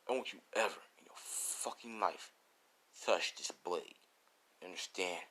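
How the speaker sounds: low, quiet, menacing warning; serious threat. Acted. Swordsman warning All Might never to touch the blade, quietly menacing. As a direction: threaten softly, holding the voice down. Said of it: "He says it like that".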